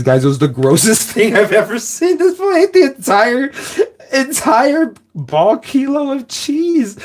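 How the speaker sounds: high-pitched amazed squeal, stretched out slow; incredulous. Real, not acted. Telling friends about the cheese guy, shrieking in disbelief.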